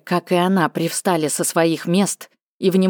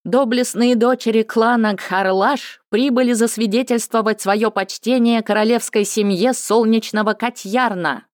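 higher, ceremonious herald call; town crier; 6 semitones higher than usual. Acted. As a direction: announce the guests grandly. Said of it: "торжественный голос глашатая".

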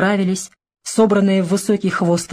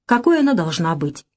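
ordinary delivery

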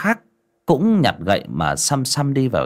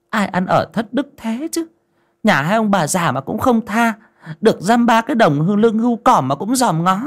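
high-pitched, indignant grumbling; scandalised villager's complaint; disparaging neighbour; 12 semitones higher, 4 dB louder than usual. A narrator's reading. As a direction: tut over the thief's lack of morals. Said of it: "Lại có người gièm pha".

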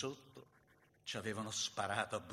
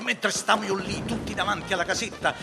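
loud, raised, driving recitation; heated battle narration; 5 semitones higher, 10 dB louder than usual. A narrator's reading. Narrator telling of the firefight at the cottage, loud and urgent. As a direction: tell the battle over the din of gunfire.

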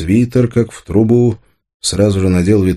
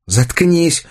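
hateful snap; 11 semitones higher than usual. A narrator's reading. Anya shuts Arsen up, snapping with hatred. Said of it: "рявкнула Аня, и в её голосе было столько ненависти".

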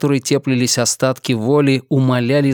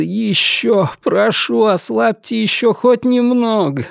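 pleading prisoner; 9 semitones higher than usual. Acted. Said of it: "Ему так хотелось взмолиться".

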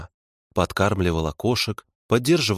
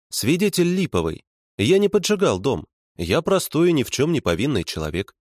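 higher-pitched protest of innocence; indignant protest; 7 semitones higher than usual. A narrator's reading. Accused man denies burning the house, indignant. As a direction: plead innocence in a raised pitch.

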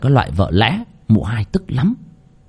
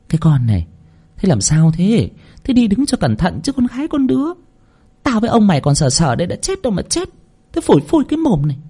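high-pitched fussing scold; exasperated chiding; 8 semitones higher than usual. A narrator's reading. Mistress helping up the fallen maid, exasperated high chiding. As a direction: chide the clumsy girl in a raised pitch.

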